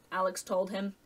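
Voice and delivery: usual speaking voice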